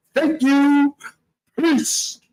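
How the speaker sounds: high, breezy put-on voice; snatch it and sign off cheekily, pitched high; mock smug thief. Thief grabbing the rotisserie chicken, cheeky high voice. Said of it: "He's like".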